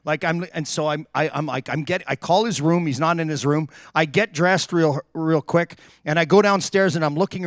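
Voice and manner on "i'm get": ordinary delivery, nothing marked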